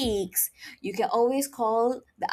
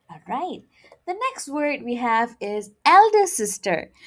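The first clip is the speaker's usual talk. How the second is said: normal talking voice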